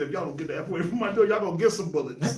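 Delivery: fast, run-together mock threat; neighbor's threat retold quickly. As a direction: rattle off the warning. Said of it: "He said".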